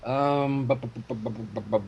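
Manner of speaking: ordinary talk, nothing marked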